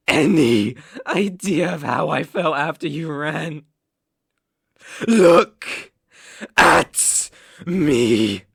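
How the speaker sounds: loud, bursting demand; explode, then bark each word; angry outburst. Acted. Betrayed captor demands rabbit look at him, angry outburst.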